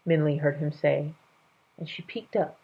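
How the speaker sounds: usual speaking voice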